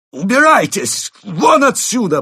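high, loud, furious shout; demanding. A narrator's reading. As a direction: order them out at full volume. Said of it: "потребовал он".

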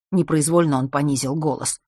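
ordinary delivery, nothing marked

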